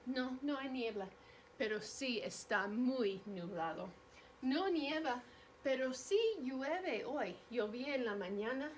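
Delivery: ordinary delivery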